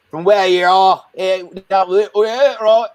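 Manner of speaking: high, put-on Aussie accent. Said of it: "do an Aussie accent".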